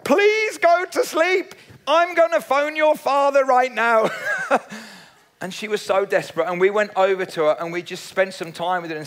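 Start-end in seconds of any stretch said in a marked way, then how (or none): 0.0-4.1 put-on begging the toddler to sleep; exasperated mum. Preacher mimics a desperate mum pleading with her toddler. 4.2-4.8 laugh. Real, not acted. Laughs after mum impression.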